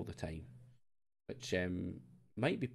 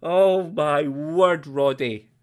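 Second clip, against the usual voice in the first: loud, slow drawn-out disbelief; real dismay at the red card; shocked dismay; 4 semitones higher, 9 dB louder, much slower than usual. Real, not acted. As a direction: stretch out the shock.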